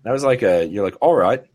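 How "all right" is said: mock British "all right". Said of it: "Is that like a British".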